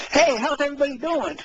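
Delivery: breezing in greeting everyone at once; cheery greeting. Retold or imitated. Friend imitating a guy greeting everybody, a cheery hello. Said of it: "I wanna come in here like".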